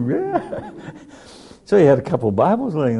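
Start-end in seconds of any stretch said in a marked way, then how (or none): 0.1-0.7 high, embarrassed giggly yeah; sheepish laughing yeah. Retold or imitated. Guest admits faith to scoffing pilot, sheepishly laughing. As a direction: squeak out a shy laughing yes.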